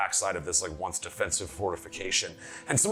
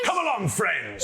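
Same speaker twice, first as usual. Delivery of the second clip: orc voice; 9 semitones higher, slower than usual. Acted. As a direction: call them along heartily. Orc calls friends along.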